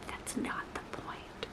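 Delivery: soft, slow whispered aside; hushed slow aside. Real, not acted. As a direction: drop to a secret whisper. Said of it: "unvoiced whisper".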